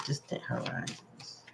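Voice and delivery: a hushed echo, barely there; whispered. Real, not acted. Reader echoes the card phrase to herself, whispered. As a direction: whisper the words to yourself.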